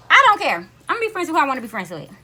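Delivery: loud, fast defiant outburst. Real, not acted. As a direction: shrug it off, firm and quick. Defiant about friendship choice.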